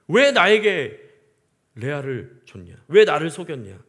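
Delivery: loud indignant demand; angry protest. Retold or imitated. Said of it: "야곱은 분해서요, 막 따졌어요". (분해서 크게 따지는 목소리)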